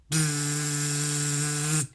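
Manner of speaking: soft buzzing zap noise; electric buzz. Acted. Narrator voicing the fence shocking Rocky, soft buzz.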